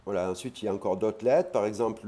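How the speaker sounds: ordinary delivery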